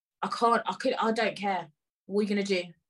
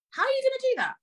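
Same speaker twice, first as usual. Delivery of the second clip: much higher, outraged "do that"; shrill disbelief; faster than usual. Acted. To the staff, outraged high-pitched disbelief. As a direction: top of the voice, can't believe it. Repeats the question, escalating.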